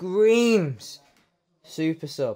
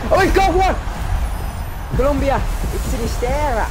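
thrilled; 9 semitones higher, 4 dB louder than usual. Real, not acted. Reveals a great card in pack.

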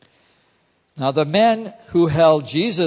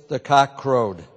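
usual speaking voice

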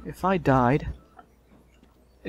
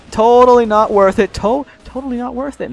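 loud, high and emphatic, easing in volume; worked-up exclamation; 9 semitones higher, 11 dB louder than usual. Real, not acted. Player abandoning a risky move, worked-up high voice. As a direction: exclaim it while bailing out. Fleeing danger in the game.

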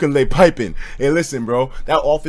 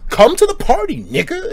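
high, lively call; excited invite; 4 dB louder than usual. Retold or imitated. Inviting the dealer to the party, excited. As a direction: pull him to the party. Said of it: "I was like".

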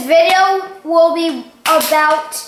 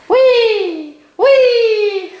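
zombie; 6 semitones higher, 3 dB louder than usual. Shown as a demonstration. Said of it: "This is what a zombie sounds like".

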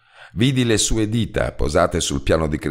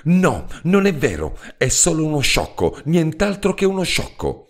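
shouting; 12 semitones higher than usual. A narrator's reading. Katie shouting at her husband in denial. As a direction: fling the denial out, pitched high. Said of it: "E gridò".

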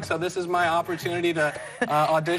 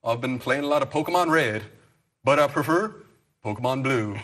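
[name] impression; 4 semitones lower than usual. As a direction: mumble it like [name]. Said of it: "this is [name]".

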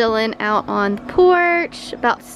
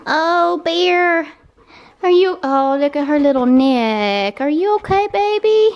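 worried parent; 6 semitones higher than usual. Real, not acted. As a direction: call out to the hurt puppy, concerned. Sees swollen neck.

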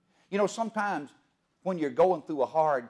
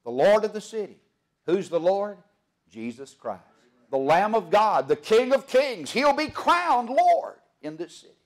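rising, louder preacher's cry; preaching at full force; 7 semitones higher, 7 dB louder than usual. Acted. Preacher proclaiming Christ's crown to the congregation, ringing full force. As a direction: lift the church with a ringing proclamation.

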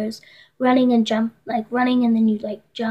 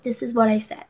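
usual speaking voice

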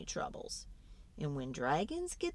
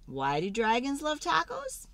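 ordinary delivery, nothing marked

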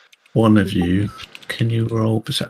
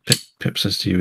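ordinary talk

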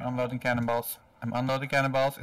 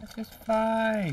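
slow, high ironic calm; deadpan this-is-fine; 9 semitones higher, much slower than usual. Acted. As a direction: drawl it like nothing is wrong. Ship wrecked, meme line.

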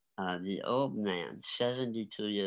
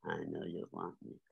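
soft, lowered voice; low seductive line; 4 semitones lower, 9 dB quieter than usual. Acted. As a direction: say it low and sure, almost privately. Repeats his stage line.

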